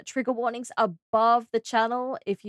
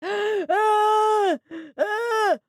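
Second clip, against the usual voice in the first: high gasping cry; surprised gasp; 9 semitones higher, 4 dB louder than usual. Real, not acted. Streamer gasping at something on screen.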